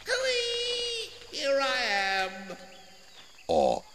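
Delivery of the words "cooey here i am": very high, slow singsong call; drawn-out, high-pitched teasing call; calling out cooey. A narrator's reading. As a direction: call out high and softly, as if from far off. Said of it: "It called".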